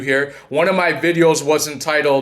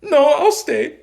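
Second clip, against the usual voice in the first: high, put-on falsetto wife voice; imitating ex-wife; 12 semitones higher than usual. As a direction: mimic her in a mock-pitiful squeak. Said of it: "And then she's just like".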